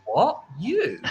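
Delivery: high, incredulous "What? You?"; mock disbelief. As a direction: act shocked at the customer. Staff disbelief retold.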